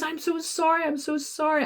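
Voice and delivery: high, flustered imitation of a panicking man; mock-flustered high apology. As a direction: squeak out the sorry like a rattled worker. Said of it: "And he goes".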